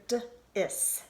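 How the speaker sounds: "d-is" split into d, then is; sounded out. Shown as a demonstration. Teacher sounding out the first syllable, broken into sounds. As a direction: say "d-is" with the d on its own. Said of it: "So I hear".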